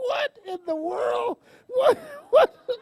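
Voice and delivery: high, crying laughter through the words; laughing so hard it nearly cries; laughing disbelief. Real, not acted. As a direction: squeal it out in disbelief. Reacting to all her medication.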